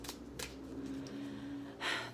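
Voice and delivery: long breathy sigh of resignation; ram's sigh. A narrator's reading. Ram sighing to the little dog, weary. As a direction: let the old ram sigh out, lonely. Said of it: "The ram sighed".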